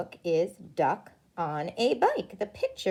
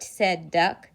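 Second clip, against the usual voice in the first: plain reading voice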